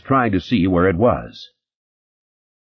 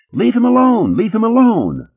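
very high, loud scream; 5 dB louder than usual. Acted. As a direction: shriek while beating him with her fists. Redhead pounding on narrator.

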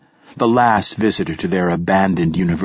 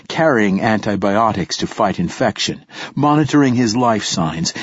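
usual speaking voice

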